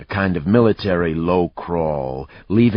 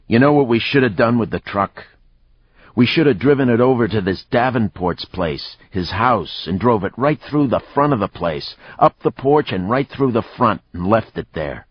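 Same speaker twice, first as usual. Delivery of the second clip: animated, raised voice; excited scheming; 6 semitones higher, 4 dB louder than usual. A narrator's reading. Man fresh from a fight pitching a scheme, excited. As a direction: spin out a wild idea with relish.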